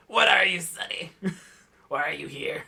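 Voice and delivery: put-on old woman voice. DM voices grandma in library.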